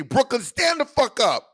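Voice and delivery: high, slow hype-man call; rally the crowd; hype shout. Pretend. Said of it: "It's just fun".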